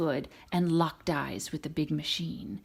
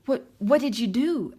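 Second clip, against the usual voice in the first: eager question; 6 semitones higher, 6 dB louder than usual. Acted. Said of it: "asked Lightning".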